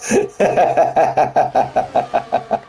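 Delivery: real laugh. Real, not acted. Laughs after a race moment.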